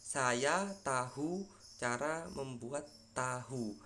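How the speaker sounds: "Saya tahu cara membuat tahu" read slowly word by word as spelled; slow careful reading of "tahu" and "tahu"; written reading, slow. Shown as a demonstration. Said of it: "Tapi secara penulisannya".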